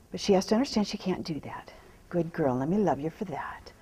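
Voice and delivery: soft hushed whisper to the mare. Real, not acted. Trainer soothing her nervous horse, whispering. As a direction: breathe the words to calm her.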